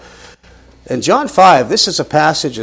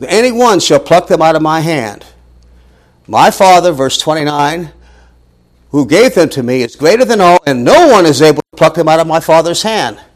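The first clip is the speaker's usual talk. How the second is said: emphatic scripture reading; 3 semitones higher, 5 dB louder than usual. Acted. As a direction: lean hard on every phrase. Scripture read with force.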